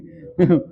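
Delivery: a quick amused laugh; brief chuckle; short laugh. Real, not acted. Laughs after mock boast.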